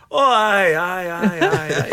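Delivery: high, delighted mock Scottish accent; put-on Scottish accent. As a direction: exclaim in hammed-up Scots. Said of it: "conducted the whole interview in Scottish accent".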